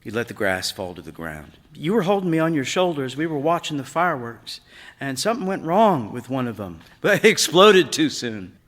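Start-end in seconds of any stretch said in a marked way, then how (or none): 1.8-8.6 put-on young boy Eric, higher and louder, recalling with rising excitement; boy's voice, higher, telling a memory fast. A narrator's reading. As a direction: voice the son, pitched up and eager.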